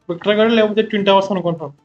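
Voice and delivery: ordinary delivery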